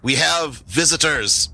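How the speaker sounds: high-pitched goblin command; high goblin voice, slowing on the announcement; goblin calling out. Acted. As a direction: call out from inside the fort in a high voice. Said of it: "You hear a voice coming from inside".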